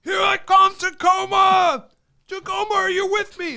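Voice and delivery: high, loud, slow arena yell; rock star shout. Retold or imitated. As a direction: scream it to the Tacoma crowd. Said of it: "and he's like".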